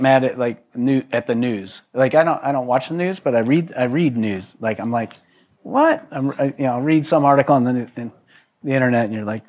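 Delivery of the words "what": sudden high incredulous what; jolted by a headline, disbelieving; shocked what. Retold or imitated. Reading bad news online, shocked disbelief.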